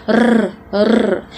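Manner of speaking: R rolled as a long trill; French uvular trill. Shown as a demonstration. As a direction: roll "R" on its own, French style. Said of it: "the ovular trill".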